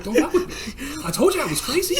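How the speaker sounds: high-pitched, through laughter; comic threat while cracking up; mock crazy-guy boast. Crazy sniper warning everyone, laughing mock threat.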